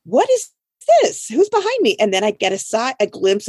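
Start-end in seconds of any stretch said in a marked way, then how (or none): 0.1-2.0 high, puzzled what-is-this; puzzled surprise. Retold or imitated. Retelling a car pulling in behind her, puzzled. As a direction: wonder aloud who's following you. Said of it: "And I'm like".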